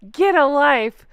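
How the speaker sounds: normal talking voice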